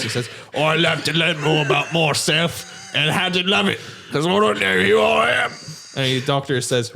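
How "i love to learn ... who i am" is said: hammed-up British outpouring, climbing much higher; slow, broad mock British wail; Benedict Cumberbatch. Answering the doctor, hammed-up British outpouring. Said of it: "says in his different voice".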